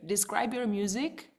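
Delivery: ordinary delivery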